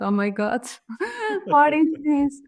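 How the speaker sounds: stunned retold reaction broken by a laugh; laughing disbelief. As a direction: laugh mid-line, then pitch up in disbelief. Retells her stunned reaction, laughing.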